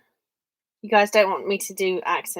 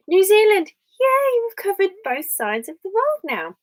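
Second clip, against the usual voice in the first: excited cheer; 10 semitones higher, 7 dB louder than usual. Real, not acted. New viewer joins from New Zealand.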